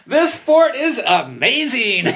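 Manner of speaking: high and loud, slow, laughing through the words; laughing delight. Retold or imitated. Young racer thrilled to be leading, laughing high and slow. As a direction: gush it with a laugh of pure joy. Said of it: "I'm like".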